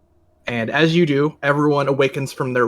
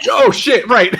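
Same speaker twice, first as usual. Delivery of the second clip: laughing exclamation; 9 semitones higher, 8 dB louder, slower than usual. Shown as a demonstration. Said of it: "do this in character, where you're like".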